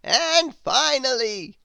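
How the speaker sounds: high, slow, loud wizard; a grand old wizard, astonished. Acted. The Wizard puzzled at Grizzle's noises, high wizard voice.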